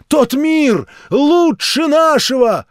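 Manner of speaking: high, loud, slow preaching voice; fervent street-preacher sermon; zealous old preacher. A narrator's reading. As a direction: warn him of doom like a revival preacher.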